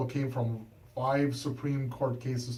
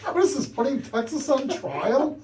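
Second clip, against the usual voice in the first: mock disbelief; 12 semitones higher than usual. Host reacting to a case from Texas, mock disbelief. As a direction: exclaim it pitched way up, half laughing.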